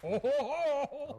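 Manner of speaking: loud, high, slow ho-ho-ho; Santa belly laugh. Pretend. Santa wraps up his visit, jolly ho-ho-ho. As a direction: boom out a drawn-out Santa laugh.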